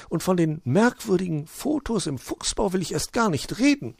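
high magpie voice, prattling; chattering magpie. Acted. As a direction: gossip without pausing. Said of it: "Frau Elster plapperte einfach weiter".

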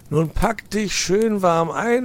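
high, loud fussing uncle; bossy old fox. Acted. Uncle fox hurries nephew out, fussy. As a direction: hurry the boy along.

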